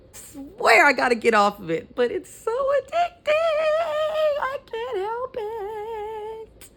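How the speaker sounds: giggling, voice jumping high into a squeal; laughing confession. Real, not acted. Vlogger admits she's hooked on being petty, laughing. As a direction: laugh through it, can't stop being petty. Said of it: "I'm being petty today".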